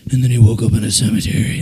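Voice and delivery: low, quick storyteller's cliffhanger; storyteller imitation. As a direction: drop into an ominous narrator's low voice. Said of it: "And then he's like".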